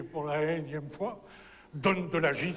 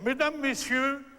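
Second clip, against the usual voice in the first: raised, measured announcer voice; advertising announcement; 6 semitones higher than usual. Acted. Sinking artist addressing the audience, high-pitched publicity announcement. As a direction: proclaim it like a sponsor message. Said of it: "Il fait une annonce publicitaire".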